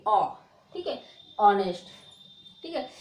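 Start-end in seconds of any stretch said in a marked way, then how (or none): 1.4-1.9 ऑनेस्ट said louder, opening on ऑ with no h; silent h honest. Shown as a demonstration. Teacher repeats the correct honest for students. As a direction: say ऑनेस्ट firmly with no h. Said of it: "अ निकालोगे".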